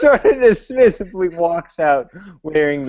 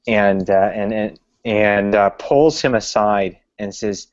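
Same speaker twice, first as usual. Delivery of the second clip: normal talking voice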